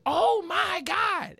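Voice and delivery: very high, very slow mock exasperation; exasperated. Teller mocking the annoyed driver, drawn-out high groan. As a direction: stretch it out high, fed up. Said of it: "And then she was like".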